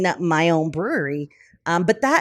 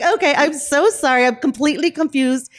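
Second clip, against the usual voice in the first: laughing apology; 9 semitones higher, 4 dB louder than usual. Retold or imitated. Embarrassed apology on the phone.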